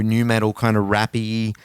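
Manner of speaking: ordinary delivery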